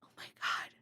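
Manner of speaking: hushed, voiceless "oh my God"; stunned whisper. Retold or imitated. Streamer retelling a reaction to the tarot reader, stunned whisper. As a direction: whisper it in mock horror.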